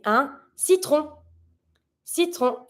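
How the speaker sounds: French citron. Shown as a demonstration. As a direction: say citron with a nasal ending. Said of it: "make the sound un".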